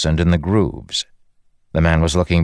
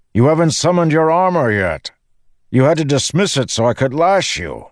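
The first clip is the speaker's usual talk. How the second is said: high, raised calling voice; shouting; 11 semitones higher, 3 dB louder than usual. A narrator's reading. Windrunner correcting his confused companion in flight, shouting. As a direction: explain it at a shout over the wind. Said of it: "he shouted".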